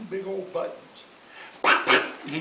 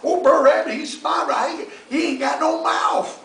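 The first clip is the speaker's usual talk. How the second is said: louder, higher laughing bear voice; Brer Bear scoffing; 6 semitones higher, 6 dB louder than usual. Acted. Bear mocking fox's tar baby, loud scoff. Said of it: "said Brer Bear".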